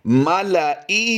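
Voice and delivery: मालाइही recited slowly in a high melodic chant; teacher's model recitation of मालाइही; Quranic recitation. Shown as a demonstration. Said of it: "तो आइए इसे फिर दोबारा पढ़ते हैं".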